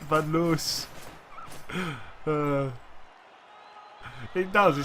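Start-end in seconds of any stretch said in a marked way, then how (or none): 0.0-0.9 "Van Loos" with a long oo; attempted pronunciation. Shown as a demonstration. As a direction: try out "Van Loos", drawing out the oo. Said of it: "Is that how you say it?".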